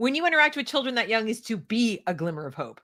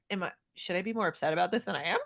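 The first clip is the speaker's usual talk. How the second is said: worried inner voice; 5 semitones lower, 8 dB quieter, faster than usual. Retold or imitated.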